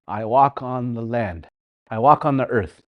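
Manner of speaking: usual speaking voice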